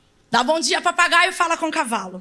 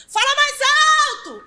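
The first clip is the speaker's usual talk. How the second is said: preacher's shout; 12 semitones higher, 4 dB louder than usual. Retold or imitated. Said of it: "Certo pregador disse".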